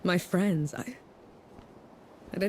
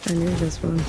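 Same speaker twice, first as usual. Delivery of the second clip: ordinary talk, nothing marked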